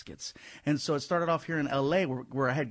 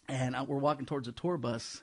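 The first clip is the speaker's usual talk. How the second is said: usual speaking voice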